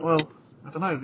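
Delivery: ordinary talk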